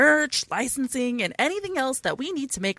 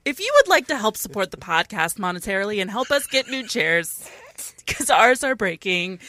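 ordinary talk, nothing marked

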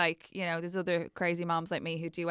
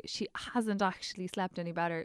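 usual speaking voice

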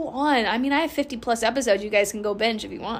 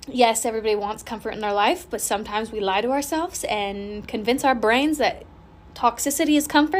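normal talking voice

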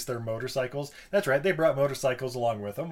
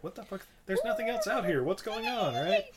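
puzzled campers; 7 semitones higher than usual. Retold or imitated. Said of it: "they're like".

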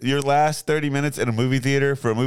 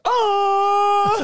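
high loud howl; wolf howl; 5 dB louder than usual. Retold or imitated. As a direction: howl at the moon. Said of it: "I just let out a".

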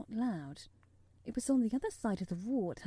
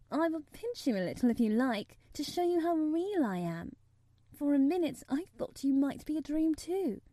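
put-on higher, lively girl's voice; earnest girl, pitched up; earnest young girl; 5 semitones higher, 4 dB louder than usual. A narrator's reading. Girl proving to boy she is real, earnest.

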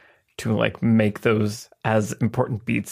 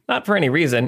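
normal talking voice

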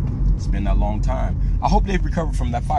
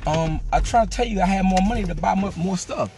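put-on pleading kid; 9 semitones higher than usual. Boy pleads with his pops at the fish market, high and eager. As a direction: beg like an excited boy. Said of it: "I'm like".

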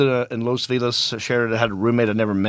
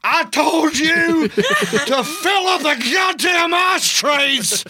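high, drawn-out full-throated holler; hammed-up Texan tantrum; mock furious scream; 4 dB louder, much slower than usual. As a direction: scream each word slowly as the raging roommate. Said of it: "he got really mad".

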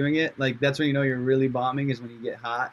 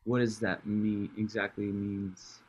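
slow, soft, low pretend confusion; drawn-out, deadpan mock bafflement; feigned puzzlement; 5 semitones lower, 7 dB quieter, slower than usual. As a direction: grope slowly for the meaning, playing clueless. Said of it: "I was just pretending I didn't know".

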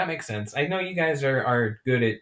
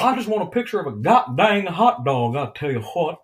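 catchphrase character voice; 4 semitones higher, 3 dB louder than usual. Acted. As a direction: mimic the twangy cartoon character. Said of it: "You gotta have the what".

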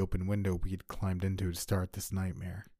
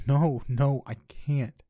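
sobbing; 6 semitones higher, 7 dB louder, much slower than usual. Acted.

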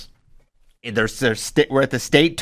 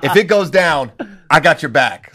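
put-on louder, eager country boy ready to fight; fired-up kid; 4 semitones higher, 4 dB louder than usual. Kid wrestler tells coach he'll fight, loud and eager. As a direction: pump it up like a kid itching for a scrap.

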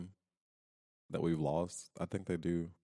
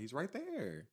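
put-on small child voice; 10 semitones higher, 4 dB quieter, slower than usual. Child voiced in retold video.

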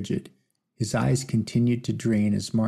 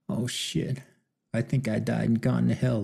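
usual speaking voice

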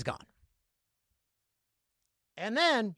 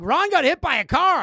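higher and louder, alarmed; urgent news; 8 semitones higher, 7 dB louder than usual. Retold or imitated. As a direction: blurt it out in a panic. Neighbours announcing the cat's accident.

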